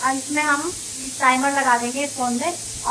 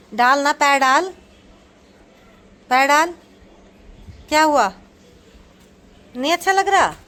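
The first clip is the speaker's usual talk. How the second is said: raised coaxing voice; 4 semitones higher, 7 dB louder than usual. Real, not acted. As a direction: urge the little one to push his foot in. Coaxing child into slippers.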